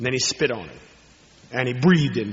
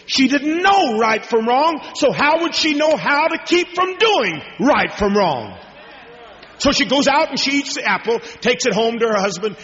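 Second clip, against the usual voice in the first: raised, driving preacher voice; fervent preaching; 7 semitones higher than usual. Acted. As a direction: press it home to the congregation. Preaching at full force again.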